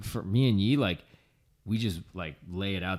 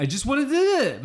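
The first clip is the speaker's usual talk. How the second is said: mock whiny self; 14 semitones higher, 3 dB louder, much faster than usual. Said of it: "I don't wanna".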